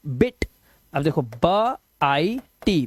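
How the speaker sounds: normal talking voice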